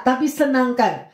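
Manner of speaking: ordinary delivery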